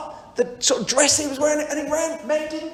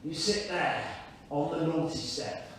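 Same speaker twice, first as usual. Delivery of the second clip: mock stern father; 9 semitones lower, 10 dB quieter than usual. Father answering son's apology, lower, softly mock stern. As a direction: lower and softer, a dad mildly scolding. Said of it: "He goes".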